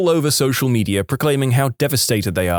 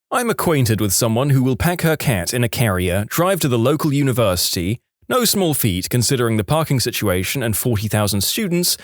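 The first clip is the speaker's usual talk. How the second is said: usual speaking voice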